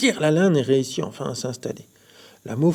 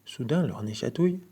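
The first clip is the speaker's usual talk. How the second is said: plain reading voice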